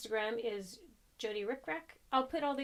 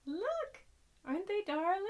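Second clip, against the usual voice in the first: gushing; 10 semitones higher, 3 dB louder than usual. Real, not acted. Showing off earrings.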